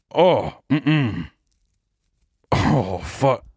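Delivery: normal talking voice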